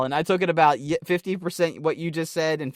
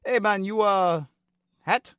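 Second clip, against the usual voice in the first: imitated Nigerian broken English, higher pitched; hesitant foreigner's broken English, pitched up; 7 semitones higher than usual. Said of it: "They speak very broken English".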